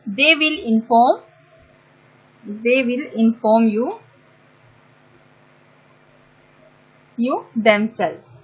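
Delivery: slow, word by word dictation; dictating at writing speed. Shown as a demonstration. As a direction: spell it out slowly as you write. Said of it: "dictating slowly while writing".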